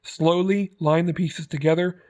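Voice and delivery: ordinary talk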